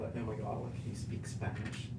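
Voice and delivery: whispered, breathy mock gossip; hushed whisper. Mimicking people whispering about him, in a hushed voice. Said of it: "people are thinking".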